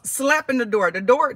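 normal talking voice

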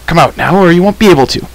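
high, forceful warning shout; shouted threat. A narrator's reading. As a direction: shout an ultimatum. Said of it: "Taran shouted".

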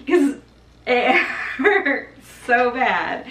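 slow, laughing through it. Real, not acted. Mother laughing as she recalls how much labor hurt. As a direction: giggle while saying it hurts.

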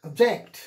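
"Object" with a loud "-ject"; stress on second syllable. Shown as a demonstration. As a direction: punch the end syllable of "Object". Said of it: "accent is given on the second syllable".

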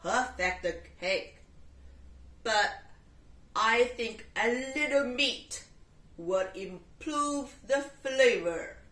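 loud, lightly voiced tiger; a hint of a big cat. Tiger telling the cat meat would help.

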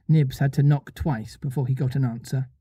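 ordinary delivery

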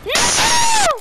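high shout of dismay; anguished yell. Real, not acted. Losing the round.

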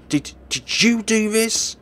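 high, loud, panicked; terrified scream. Acted. Girl facing the killer, terrified. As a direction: shriek in fear.

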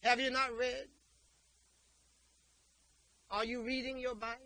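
Jesus calling out to his listeners; loud voicing of Jesus. Preacher voicing Jesus to the congregation, high and loud. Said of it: "But he said".

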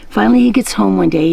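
usual speaking voice

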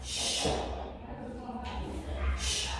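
soft, hushed "Sh", repeated; isolated sh sound. Shown as a demonstration. Teacher shows pupil the sound, soft isolated "Sh". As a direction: say "Sh" alone as a soft hiss of air. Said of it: "Okay, this is the sound".

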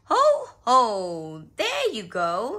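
loud, hearty ho-ho dad; Daddy Pig. A narrator's reading. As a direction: hand it over with booming cheer. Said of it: "snorted Daddy Pig".

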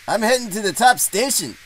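high character voice, touched with relief; relieved Donald. A narrator's reading. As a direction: sound relieved, pitched well up. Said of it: "Donald sighed with relief".

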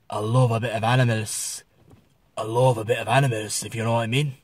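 low-pitched northern English voice; northern English accent. Shown as a demonstration. Vlogger showing an expression from the north, northern accent. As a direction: put on a subdued northern accent. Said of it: "It's the northern expression".

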